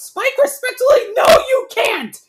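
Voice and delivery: very high, loud shriek; shout. A narrator's reading. Twilight tells the dragon he can't, shouting shrilly high. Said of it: "Twilight shouts".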